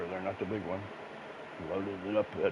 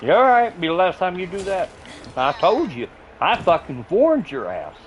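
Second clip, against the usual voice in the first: real gloating shout; 10 semitones higher, 10 dB louder than usual. Real, not acted. Streamer finishing off a game enemy, gloating shout. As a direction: scream the victory at the beaten foe.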